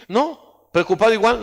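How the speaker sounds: ordinary delivery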